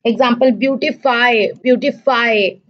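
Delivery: ordinary talk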